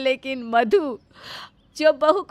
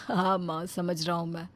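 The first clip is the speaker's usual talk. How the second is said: lower, cheerful son coming home; a lighter male son's voice, lower; 8 semitones lower, 10 dB quieter than usual. Acted. Son arriving home, sniffing the cooking, pleased.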